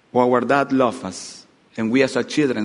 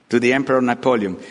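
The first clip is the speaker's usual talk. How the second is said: ordinary talk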